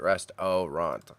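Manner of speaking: "Restaurant" drawn out slowly as rest-OH-ront; spelling mnemonic pronunciation. Shown as a demonstration. Streamer repeating a memory trick for spelling, "Restaurant" as slow rest-oh-ront. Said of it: "that's how I remember it".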